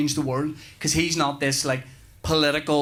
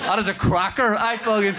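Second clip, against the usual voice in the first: ordinary talk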